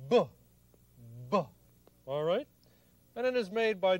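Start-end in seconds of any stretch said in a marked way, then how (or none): 0.1-1.4 "B" as a short voiced lip pop; b sound. Shown as a demonstration. Teacher showing kids the letter sound, popped B. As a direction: pop the lips for "B". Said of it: "the sound that the letter B makes is".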